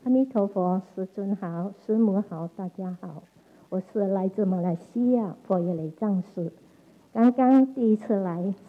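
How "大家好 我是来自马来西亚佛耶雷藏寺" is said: normal talking voice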